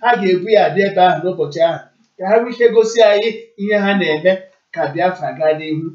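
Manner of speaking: normal talking voice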